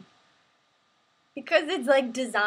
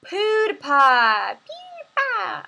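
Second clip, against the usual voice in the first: loud, drawn-out high call of the name; excited shout; 10 semitones higher, 12 dB louder, much slower than usual. Acted. Streamer calling out to PewDiePie in an excited squeal. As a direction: yell it out like a fan, rising to a squeal.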